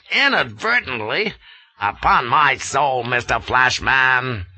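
Inadvertently rising in disbelief, then scolding; incredulous echo. A narrator's reading. Colonel mocking Flashman's excuse, incredulous. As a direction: throw his word back at him, outraged. Gardner echoing.

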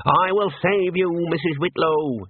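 a mild heroic cry to the rescue. A narrator's reading. Senior wrangler vows to save the housekeeper, heroic cry. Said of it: "he cried".

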